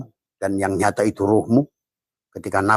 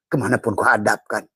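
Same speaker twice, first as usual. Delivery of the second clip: normal talking voice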